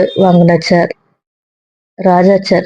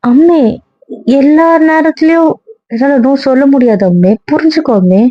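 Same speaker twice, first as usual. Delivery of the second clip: much higher, pleading cry of protest; desperate plea; 7 semitones higher than usual. Acted. Scene: wife begging her husband to see she can't always say no, high desperate plea. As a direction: beg him to understand, voice pitched up high.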